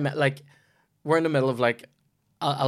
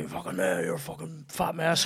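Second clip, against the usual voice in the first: imitated heckler; 5 semitones higher, 6 dB quieter than usual. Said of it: "And he".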